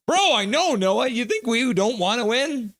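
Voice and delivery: very high, loud outburst; exasperated protest. Real, not acted. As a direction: squeal back in exasperated disbelief. Exasperated reply to a fan's comment.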